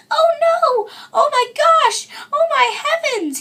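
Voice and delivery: put-on Baby Bear squeals in alarm at the intruder, tiny high voice; wee little voice. A narrator's reading. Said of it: "he cried in his wee little voice".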